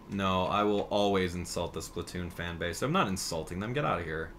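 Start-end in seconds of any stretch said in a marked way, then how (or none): none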